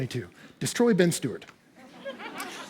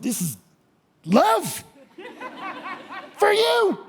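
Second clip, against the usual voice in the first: overwhelmed cry of love; 11 semitones higher, slower than usual. Real, not acted. Overwhelmed holding newborn daughter.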